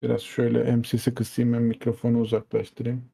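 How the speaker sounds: usual speaking voice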